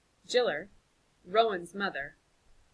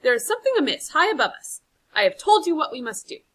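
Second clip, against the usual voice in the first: raised, urgent and insistent; forceful insistence; 7 semitones higher, 12 dB louder than usual. Acted. Villager urges council, loud and insistent. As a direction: press the point hard on the council.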